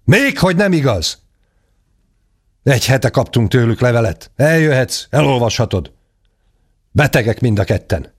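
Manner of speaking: magasabb, éles felcsattanás; nevetségessé teszi a tagadást; dühös visszavágás. A narrator's reading. Ádám csattanva visszavág. (dühös gyerekhang)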